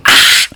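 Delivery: loud piercing scream. Acted. Freddy without his mask, loud scream. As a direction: let out a full scream. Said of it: "he goes".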